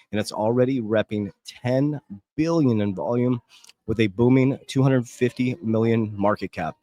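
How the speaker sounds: ordinary delivery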